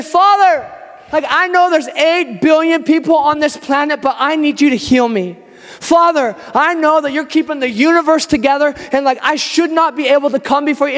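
shameless prayer. Acted. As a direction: call on the Father with unashamed insistence. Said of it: "It looks shameless to say".

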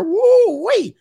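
high, loud woo wait; calling out. Retold or imitated. Man spotting his fling and calling her back, high excited call. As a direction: shout after her across the lot.

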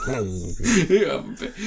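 high, slow cooing; cooing owner. Retold or imitated. Owner handing the actor food, high and slow. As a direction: dole it out sweetly like to a pet. Acting out feeding a dog.

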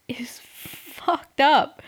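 hushed slow whisper. Real, not acted. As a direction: whisper it slowly like a secret. Blunt gossip aside.

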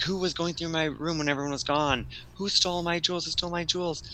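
high, indignant mock demand; accusing, freaking out. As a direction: accuse the whole room, hammed up. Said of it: "is freaking out".

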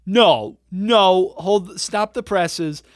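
loud then slow, stop the presses; incredulous protest. Real, not acted. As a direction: halt him, disbelieving. Protesting the claim loudly.

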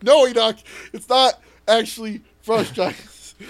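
loud, high exasperation cracking into a laugh; exasperated correction. Retold or imitated. Game master correcting Enoch after half an hour, laughing exasperation. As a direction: plead with a friend who missed the joke.

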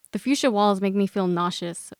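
usual speaking voice

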